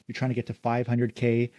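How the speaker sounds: ordinary delivery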